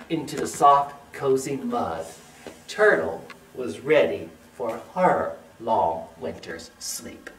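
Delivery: hushed storybook close; winding-down story narration. A narrator's reading. Winds down the story.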